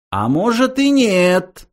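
high, slow, teasing menace; ominous. A narrator's reading. Philosopher threatening the programmers, ominous. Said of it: "зловеще сказал Врумфондель".

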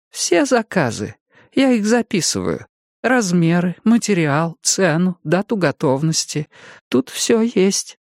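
высокий медленный старческий голос; пожилая женщина объясняет; старушка. A narrator's reading. Jenny explains folder. (голос старушки)

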